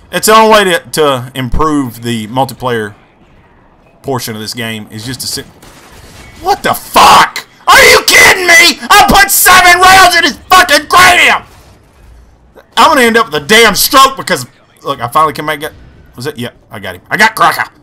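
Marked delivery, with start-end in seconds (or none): 6.4-11.4 much higher and louder, anguished shouting; furious yell. Real, not acted. Gamer raging at the game after a kill is denied, furious yell. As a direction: scream it out at the screen.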